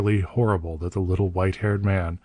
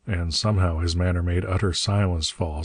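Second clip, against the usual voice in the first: ordinary delivery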